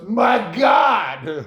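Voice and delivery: imitated awe, looking up. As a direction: look up and exclaim. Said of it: "he looks up and goes".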